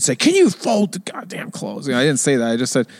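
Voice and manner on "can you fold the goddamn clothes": slow, high-pitched mock outburst without volume; hammed-up irritation, not really shouted; mock yell. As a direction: act out an exasperated demand, drawn out. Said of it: "I didn't yell".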